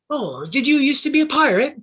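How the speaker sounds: put-on high, eager kid voice; little boy voice. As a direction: ask it like a curious little boy.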